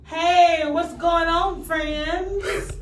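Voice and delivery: high, loud Southern drawl greeting; country accent. Acted. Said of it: "That's country".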